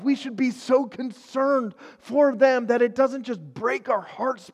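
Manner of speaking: high, loud, urgent sermon delivery; preaching at full force. Acted. Pastor urging his listeners, preaching at full force. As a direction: preach it out, pleading for the hurting.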